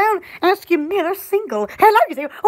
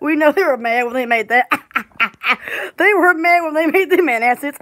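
giggling through the words; cracking up mid-sentence; laughing; 3 semitones lower than usual. Real, not acted. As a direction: laugh helplessly while talking. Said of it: "laughing fit".

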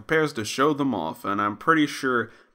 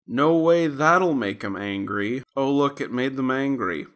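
ordinary talk

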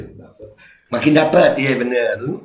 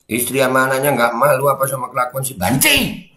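"banci" raised like capital letters; stress on banci. Shown as a demonstration. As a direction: read evenly, then punch "banci" upward. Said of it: "saya lagi belajar intonasi baca".

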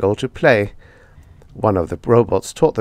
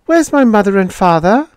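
put-on high, louder boy's voice asking; anxious boy; 12 semitones higher, 6 dB louder than usual. A narrator's reading. Boy asking the robot for his parents, high and worried. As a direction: plead like a worried child.

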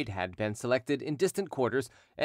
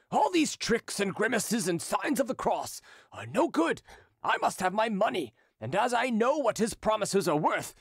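angry shouting creditor; 9 semitones higher than usual. Acted. Creditor raging at Derues before the crowd, high angry voice. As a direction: rant at the crowd and threaten him. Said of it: "continued to shout".

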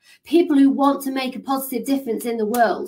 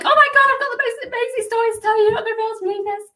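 much higher, fast and gushing; bursting with news; 12 semitones higher, much faster than usual. Retold or imitated. Friend arriving at girlfriend's house, excited gushing, high and fast. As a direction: gush like you can't hold it in. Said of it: "you're like".

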